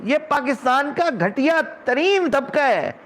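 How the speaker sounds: slow, high, emphatic outrage; indignant denunciation. Real, not acted. Indignant denunciation of those people. (غصے بھری، کھنچی ہوئی اونچی آواز)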